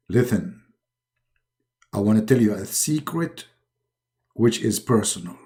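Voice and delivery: low secretive divine voice; Allah voice. Acted. God speaking to Muhammad.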